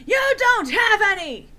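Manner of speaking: over-the-top mad shriek; yelling madly. A narrator's reading. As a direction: shout him down. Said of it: "I yelled in madly".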